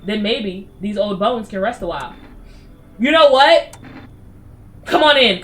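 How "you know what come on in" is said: loud eager invite; excited. Real, not acted. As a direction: burst out with the welcome.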